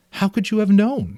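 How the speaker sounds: a light, high rise of mild surprise; asking in gentle disbelief; surprised mother. Acted. Mother asking the father, a little surprised. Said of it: "His mother seemed surprised too".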